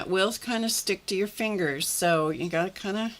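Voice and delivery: ordinary talk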